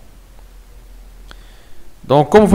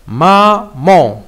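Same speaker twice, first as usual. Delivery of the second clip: "Maman" said as "ma, man", two distinct beats; split into syllables. Shown as a demonstration. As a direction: separate "ma" from "man" for a beginner. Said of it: "on doit le diviser en syllabes". (« Maman » en deux syllabes séparées, « ma, man »)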